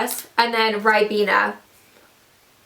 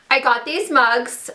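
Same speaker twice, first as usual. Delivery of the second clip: ordinary talk, nothing marked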